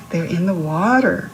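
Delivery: ordinary delivery, nothing marked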